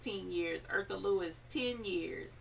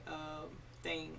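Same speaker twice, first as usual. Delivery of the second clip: usual speaking voice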